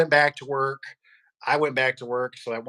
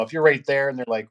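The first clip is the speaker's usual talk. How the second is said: ordinary talk, nothing marked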